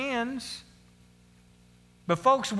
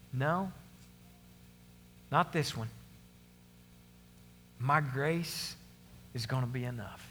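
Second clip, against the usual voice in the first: God's answer; 8 semitones lower, 9 dB quieter than usual. Retold or imitated. God refusing Paul gently, low and soft. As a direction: refuse tenderly, with long pauses. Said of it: "But God says".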